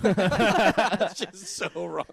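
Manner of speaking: stretched out slowly through a laugh; laughing disbelief. Real, not acted. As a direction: laugh it out, can't believe it. Reacting to crocodiles are blind claim.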